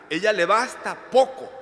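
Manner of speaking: high and loud preaching; fiery sermon peak; preaching at full force. Acted. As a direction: proclaim it to the congregation. Preaching climax.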